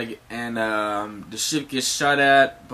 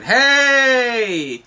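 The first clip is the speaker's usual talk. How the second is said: high, loud, long holler; calling out; 11 semitones higher, 8 dB louder than usual. Acted. A voice suddenly calls to the crew, drawn-out shout. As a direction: hail friends from far away. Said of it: "hear a voice saying".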